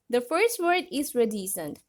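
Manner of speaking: ordinary talk, nothing marked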